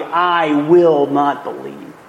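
"I will not believe" slow, each word set apart; bold declaration. Acted. Thomas answering the believers, bold, slow "I will not believe". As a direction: lay down "I will not believe" as a deliberate refusal. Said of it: "the way Thomas boldly declares".

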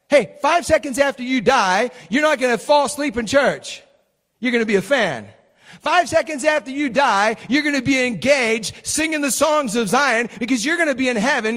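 loud, high preacher's fervor; preaching. Acted. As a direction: preach it to the back pews. Preaching at full force.

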